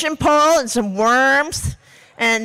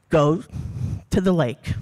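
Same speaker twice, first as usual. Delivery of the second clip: hawk's message; 7 semitones lower than usual. Acted. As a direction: deliver a low, solemn command from the hawk. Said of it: "The message".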